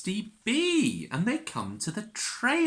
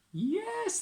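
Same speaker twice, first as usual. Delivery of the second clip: usual speaking voice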